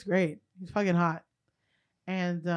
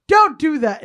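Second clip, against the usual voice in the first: loud, a bit higher scold; retold scolding; 5 semitones higher, 10 dB louder than usual. Friend loudly scolding the guy in front of everyone. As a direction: tell him off right after the slap. Girl scolds after slapping.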